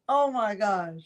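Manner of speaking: usual speaking voice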